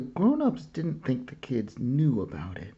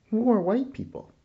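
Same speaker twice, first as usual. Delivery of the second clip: put-on child's question; 12 semitones higher, 4 dB louder than usual. A narrator's reading.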